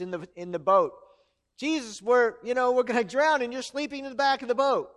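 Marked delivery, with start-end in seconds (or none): 1.6-4.9 higher, louder urgent plea; mock-panicked cry for help; calling out. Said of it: "when they called out to Jesus".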